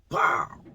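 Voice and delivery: sharp high "pow" falling away; imitated gunshot. Storyteller imitating the shot they suddenly heard, high falling gunshot noise.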